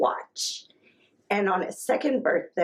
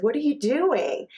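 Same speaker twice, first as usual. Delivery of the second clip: higher-pitched mock bafflement; retold bewildered question; 7 semitones higher than usual. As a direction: lightly act out a baffled mother. Retells her question to upset toddler.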